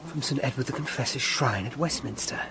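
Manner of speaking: normal talking voice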